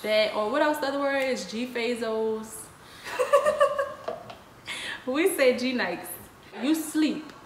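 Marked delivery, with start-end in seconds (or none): 3.0-4.3 quick high airy giggle; high breathy laugh. Real, not acted. Laughs at own word.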